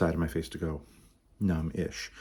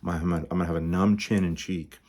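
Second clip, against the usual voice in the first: normal talking voice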